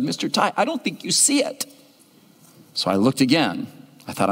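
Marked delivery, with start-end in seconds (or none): 0.0-1.7 put-on high, soft child doubting him; a gently disappointed little girl. Girl telling the pastor he misses her drawing, soft and high. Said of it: "She said".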